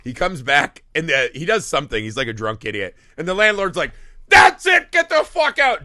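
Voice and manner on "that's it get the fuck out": high, full-volume acted outrage; angry landlord shout. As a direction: yell as if throwing out a drunk. Acting out landlord throwing drunk out.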